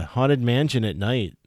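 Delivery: ordinary delivery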